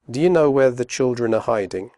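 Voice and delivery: fast, are reduced; quick natural question; quickly. Shown as a demonstration. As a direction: run the words together. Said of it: "first slowly and then quickly".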